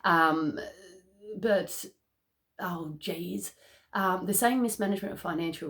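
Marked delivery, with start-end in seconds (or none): none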